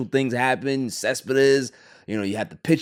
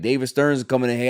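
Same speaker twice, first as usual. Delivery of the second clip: ordinary talk, nothing marked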